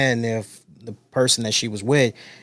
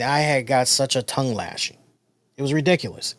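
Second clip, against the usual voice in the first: usual speaking voice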